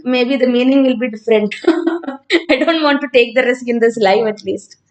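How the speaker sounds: normal talking voice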